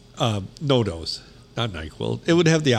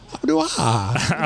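slow, dopey Sandler drawl; Adam Sandler voice; 3 semitones higher, much slower than usual. Retold or imitated. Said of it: "Do some Adam Sandler".

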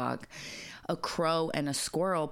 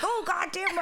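imitating old man; 12 semitones higher than usual. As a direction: yelp it high and shocked. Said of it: "as he's like".

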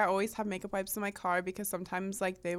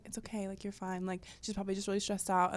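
much softer, hushed reassurance; retold whisper; 7 dB quieter than usual. Comforting an upset hairstylist, retold in a hushed voice. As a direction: drop the volume as if confiding. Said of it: "I whispered".

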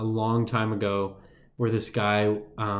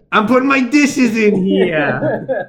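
a slow, hammed-up New York accent, high and loud, with a laugh; mock New York accent; 10 semitones higher, 9 dB louder, much slower than usual. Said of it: "do it with an accent now".

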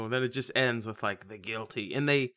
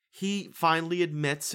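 normal talking voice

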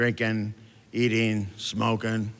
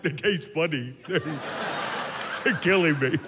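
laughing complaint; 5 semitones higher, much slower than usual. Acted. Diner laughing at his fat fries.

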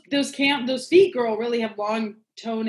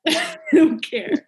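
laughing through the words, slow and higher; real laughter; 6 semitones higher, 5 dB louder than usual. Real, not acted. Friend laughs at being quoted about not caring, real laughter. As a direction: crack up while saying it. Cracks up repeating her own line.

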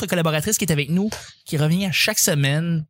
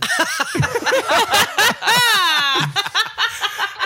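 big laugh; 14 semitones higher than usual. Real, not acted. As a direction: crack up completely. Host cracks up at joke.

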